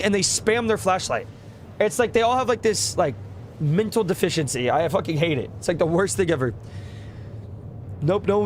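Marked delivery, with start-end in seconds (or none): none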